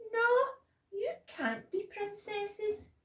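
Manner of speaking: high, loud, drawn-out refusal; bossy child. A narrator's reading. Bella to Sasha at playtime, bossy. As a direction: forbid them in a high, cross voice.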